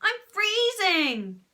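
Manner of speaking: high, loud, stretched-out yell; screeching child's complaint. A narrator's reading. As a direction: screech it slowly in protest. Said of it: "screeched Horrid Henry".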